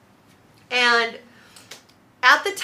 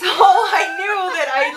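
laughing; 7 semitones higher, 7 dB louder than usual. Real, not acted. Laughing loudly.

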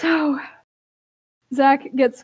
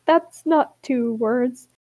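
voicing Gorsh; much slower than usual. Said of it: "He's like".

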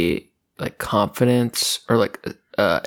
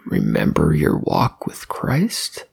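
ordinary talk

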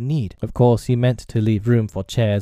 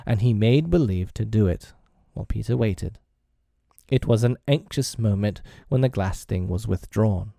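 plain reading voice

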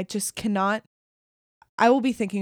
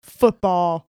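sports bro; 2 semitones lower, 5 dB louder, slower than usual. Pretend. Mock sports bro chants, loud.

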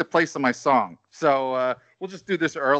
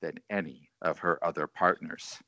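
sultry voice; 11 semitones lower, 3 dB quieter than usual. Pretend. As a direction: read it like a smooth Quiet Storm host. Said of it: "I could be like Quiet Storm here".

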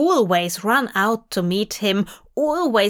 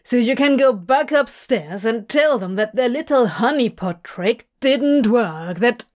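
edge in voice; 2 semitones higher than usual. Acted. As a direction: spit it out with a bitter edge. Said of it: "Crowley's voice has an edge to it now".

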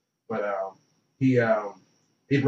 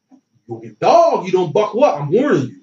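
louder, higher trash-talk warning; retold heated warning; 6 semitones higher, 8 dB louder than usual. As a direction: warn the defense off, raised voice.

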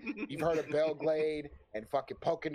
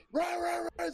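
panicked yell to run. Retold or imitated. Uncle yelling to flee gator.

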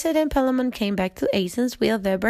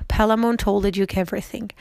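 ordinary delivery

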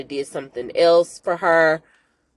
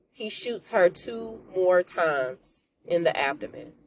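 usual speaking voice

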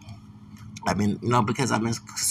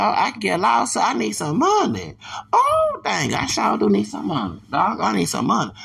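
gleeful; 8 semitones higher than usual. Real, not acted. Gleeful about a payday.

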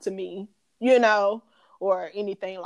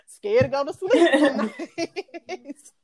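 laughing; 6 semitones higher than usual. Real, not acted. Admitting nightmares, laughing.

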